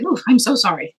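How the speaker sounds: lightly acted commiseration; playful sympathy. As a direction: coo mock condolences to the youngest. Mock sympathy for youngest sibling.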